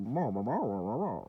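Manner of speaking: a light hint of the muffled cartoon teacher; Charlie Brown adult. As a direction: mumble like an unseen cartoon teacher. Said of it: "sounded like the fucking Charlie Brown adults".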